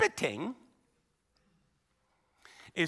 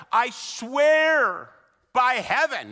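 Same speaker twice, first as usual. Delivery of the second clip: slow, louder, grand oath, swear stretched out; weighty oath; 6 semitones higher, 7 dB louder, much slower than usual. Shown as a demonstration. Preacher mocking a grand oath, slow and weighty. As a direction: make the oath sound weighty and impressive. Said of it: "Well, that's weighty".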